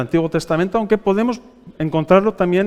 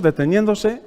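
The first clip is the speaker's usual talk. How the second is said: ordinary talk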